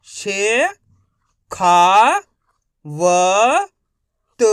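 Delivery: each syllable called out loud and separate. Shown as a demonstration. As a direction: sound out every syllable slowly with a pause. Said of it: "मेरे तरीके पे गौर करना है".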